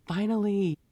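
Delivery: a relieved, teasing Lord; voicing the Lord. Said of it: "the Lord is like".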